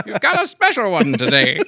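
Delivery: high, slow teasing mother; mom voice. Pretend. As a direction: play a mom talking to her son through laughter. Mother voiced answering son.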